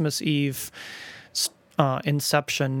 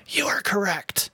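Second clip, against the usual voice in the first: booming whisper; 4 semitones higher than usual. Retold or imitated. A little boy approves the retelling with a whispered verdict. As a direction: hiss it close to the ear like a secret verdict. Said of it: "he boomed in my ear".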